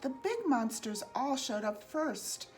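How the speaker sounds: usual speaking voice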